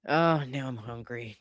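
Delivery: ordinary talk, nothing marked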